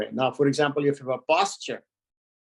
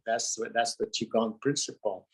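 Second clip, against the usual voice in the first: ordinary talk, nothing marked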